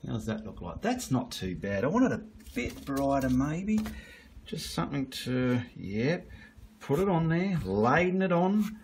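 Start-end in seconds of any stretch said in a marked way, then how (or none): none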